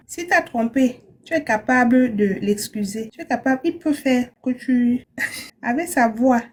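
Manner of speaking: usual speaking voice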